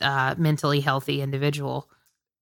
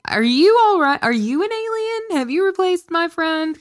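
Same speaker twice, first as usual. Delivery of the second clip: mock alarm; 11 semitones higher than usual. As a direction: play at being freaked out. Mock alarm at alien friend.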